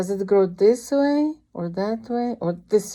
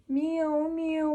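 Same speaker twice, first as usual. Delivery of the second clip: high little meows; kitten meow; 8 semitones higher, 5 dB quieter than usual. Acted. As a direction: mew like a scared kitten. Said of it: "this is baby cat".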